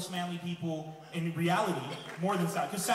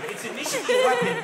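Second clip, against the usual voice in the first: laughing punchline; 9 dB louder than usual. Acted. Punchline emphasis.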